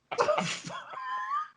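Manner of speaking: high, squealing laugh; helpless laughter. Real, not acted. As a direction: crack up at a shocking joke. Real reaction to crude punchline.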